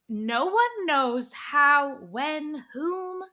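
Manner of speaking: slow, stretched tease; drawn-out mystery. Real, not acted. Teasing friend about how it happened, slow stretched.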